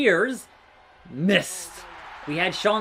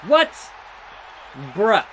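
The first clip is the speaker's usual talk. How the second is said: sudden loud surprise; 2 semitones higher, 3 dB louder than usual. Real, not acted. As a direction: blurt out in shock. Sudden reaction to game event.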